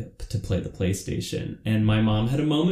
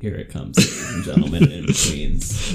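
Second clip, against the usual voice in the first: mock announcer; 4 dB louder than usual. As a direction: announce the show like a sleazy emcee. Announcing a stripper show mockingly.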